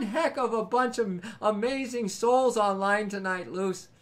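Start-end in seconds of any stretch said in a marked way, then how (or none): none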